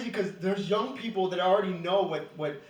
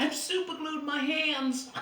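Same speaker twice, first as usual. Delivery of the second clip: mock damsel voice; 7 semitones higher, slower than usual. Acting out stuck porn scene.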